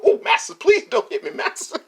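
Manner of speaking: high, loud pleading; mock pleading slave voice. Cartoon rabbit begging master, high and loud. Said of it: "used to go".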